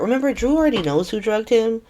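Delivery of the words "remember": usual speaking voice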